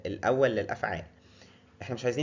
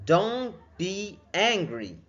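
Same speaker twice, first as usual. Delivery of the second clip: slow, higher "Don't be angry" with weight on angry; slow demo; 7 semitones higher, 4 dB louder, much slower than usual. Shown as a demonstration. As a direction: soothe someone slowly. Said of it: "teacher models a phrase". (نطق بطيء واضح)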